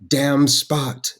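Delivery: hammed-up high call to a naughty dog; yelling at the dog. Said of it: "but she's yelling at the dog".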